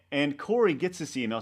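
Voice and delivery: ordinary delivery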